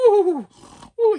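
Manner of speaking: sharp high gasp, jolted awake; high startled ooh, half mock. Woken by sudden screaming.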